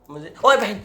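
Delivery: sudden loud high yelp; loud high "oh sister!" of shock; startled yelp. Real, not acted. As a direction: jump at the sight. Startled exclamation while cutting.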